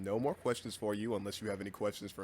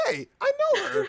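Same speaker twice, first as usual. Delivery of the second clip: much higher and louder, thrilled; excited squeal; 9 dB louder than usual. Retold or imitated. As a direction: blurt it out, delighted. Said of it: "and I was like".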